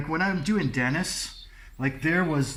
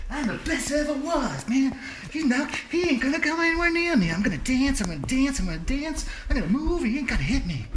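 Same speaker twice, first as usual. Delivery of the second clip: much higher, fast, cocky boxer boasting; Muhammad Ali impression; 11 semitones higher, faster than usual. Podcast host imitates Muhammad Ali boasting, high and cocky. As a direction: brag like Ali taunting an opponent, pitched way up. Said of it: "how Muhammad Ali would do it".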